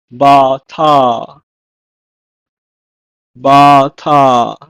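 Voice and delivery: bata in two firm, long syllables; Hebrew pronunciation. Shown as a demonstration. Teacher gives the Hebrew for trust, firm bata. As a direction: model "bata" firmly, then repeat. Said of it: "is pronounced as".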